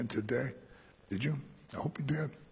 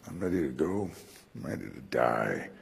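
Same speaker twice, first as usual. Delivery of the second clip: quiet resolve; 11 semitones lower, 8 dB quieter than usual. Retold or imitated. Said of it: "you look at the guy and you go".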